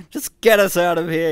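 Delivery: high, loud furious yell. A narrator's reading. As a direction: yell the order. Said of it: "yelled Hans".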